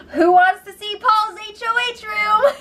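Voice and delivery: high, hammed-up gleeful call; excited announcement. Host mimics the call to see Paul's room, mock excited.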